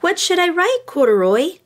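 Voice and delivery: put-on high, questioning child's voice; little girl Lisa. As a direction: ask it like a puzzled little girl.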